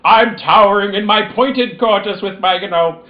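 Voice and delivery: pompous domineering mistress voice; lightly hammed-up imperious queen; dark lord. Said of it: "you're not like".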